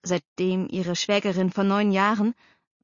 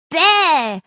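loud, high 'bleh' with tongue out; childish jeer; 9 semitones higher, 9 dB louder than usual. A narrator's reading. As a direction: jeer back at your brother. Sister sticks out her tongue. (freches, lautes Bäh)

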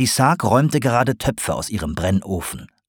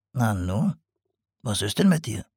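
softer, lightly voiced potter; concerned potter; 5 dB quieter than usual. A narrator's reading. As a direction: ask with quiet concern. Sarg notices owl.

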